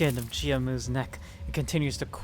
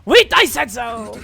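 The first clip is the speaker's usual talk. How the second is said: indignant shout; 9 semitones higher, 14 dB louder than usual. Real, not acted. Indignant outburst.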